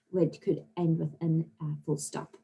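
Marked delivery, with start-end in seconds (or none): none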